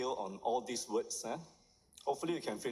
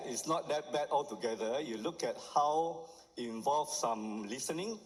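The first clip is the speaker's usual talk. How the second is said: ordinary talk, nothing marked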